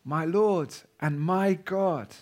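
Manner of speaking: slow, soft prayer; worshipful. Shown as a demonstration. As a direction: say it as quiet worship. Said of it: "This is worship".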